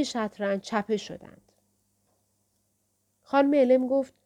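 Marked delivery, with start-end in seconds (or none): none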